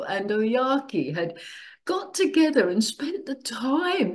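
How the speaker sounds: nearly crying as she tells it; moved almost to tears; tearful. Real, not acted. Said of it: "I'm crying".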